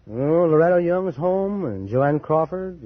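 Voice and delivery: usual speaking voice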